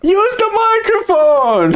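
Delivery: high, drawn-out comic holler; mock exasperated yell. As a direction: wail it slowly and high, playfully fed up. Mock pleading with loud-voiced friend.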